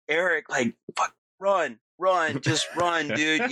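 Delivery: urgent retold scream, not at full volume; mock scream. Telepathically urging a friend to run from the cops, mock screaming.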